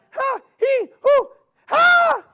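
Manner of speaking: very high yips ending in a loud squeal; squirrel yelps. Acted. Squirrel jumping to the next tree, high loud yelps. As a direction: yip three times, then squeal as you leap.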